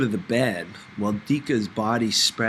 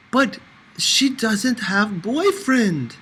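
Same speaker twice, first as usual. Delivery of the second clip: high, slow mock lament with an accent; Dika lamenting; 10 semitones higher, 5 dB louder, much slower than usual. A narrator's reading. Dika playing matchmaker, mock lament about Sophie. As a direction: sigh it out as a hammed-up complaint. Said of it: "Dika lamented".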